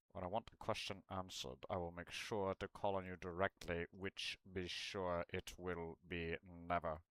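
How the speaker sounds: hammed-up Snape promising never to call on him; Snape cold threat. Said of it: "I think my Alan Rickman is good".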